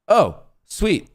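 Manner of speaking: sudden high, loud sarcastic cry; high, loud sarcastic yelp; exasperated yelp. Real, not acted. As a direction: yelp it out in disbelief. Computer freezes, sarcastic.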